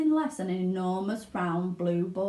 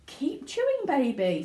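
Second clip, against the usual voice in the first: higher, cheering father; Mr Beauregard; 6 semitones higher than usual. Acted. Father cheers Violet on, proud. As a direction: egg her on. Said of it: "said Mister Beauregard".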